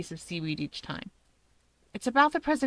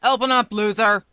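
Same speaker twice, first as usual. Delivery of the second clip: loud growl through the door; pounding-on-the-door growl; growled shout; 9 dB louder than usual. A narrator's reading. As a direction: bark it like an angry teen. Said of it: "A voice growls out".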